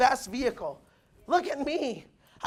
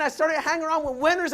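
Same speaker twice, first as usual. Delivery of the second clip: ordinary talk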